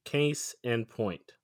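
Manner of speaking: each word drawn out and separated; spelling it out slowly; slow demonstration. Shown as a demonstration. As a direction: say "case in point" very slowly, word by word. Repeats the phrase to show it.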